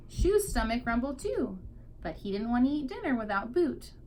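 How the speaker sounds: plain reading voice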